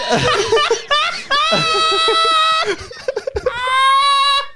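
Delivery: a long, high, shrieking fit of laughter; helpless howling laugh. Real, not acted. Friend howling at the cubicle story, shrieking laugh. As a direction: crack up completely, out of control.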